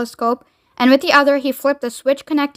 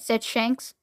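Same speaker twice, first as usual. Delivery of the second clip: usual speaking voice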